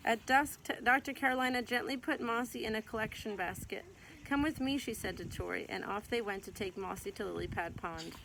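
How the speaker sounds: gentle, lively read-aloud voice; storybook reading. Acted. Mother reading a picture book to her child, gentle storytelling.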